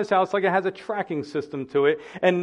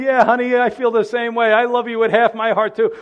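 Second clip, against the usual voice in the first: higher, louder, laughing mock wife; a wife answering her husband, laughing and louder; mock wife's reply; 6 semitones higher, 8 dB louder than usual. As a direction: play the wife tossing it back with a laugh. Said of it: "imagine her saying".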